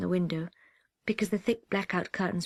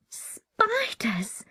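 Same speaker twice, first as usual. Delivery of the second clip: horrified whisper; 14 semitones higher than usual. Acted. Child recalls spiders in horror.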